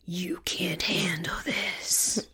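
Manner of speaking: soft, slow, breathy taunt; taunting whisper. Pretend. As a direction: whisper the dare to scare them. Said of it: "It's like".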